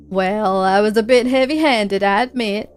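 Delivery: folksy put-on drawl, mildly irritated; hammy country homeowner voice; evasive homeowner. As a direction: play a testy man brushing off a visitor. Voices Red with accent.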